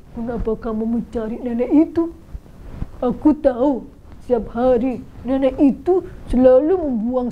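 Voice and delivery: a lightly put-on villager voice, curious and concerned; voiced villager. Villager asking Muhammad why he seeks the old woman, concerned. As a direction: question him like a worried neighbour.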